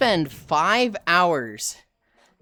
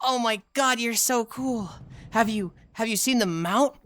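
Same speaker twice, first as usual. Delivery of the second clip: mock starstruck newbie, higher; hammed-up starstruck newbie; awed gushing; 6 semitones higher than usual. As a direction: gush like an awestruck fan. Newbies gushing over raid veteran.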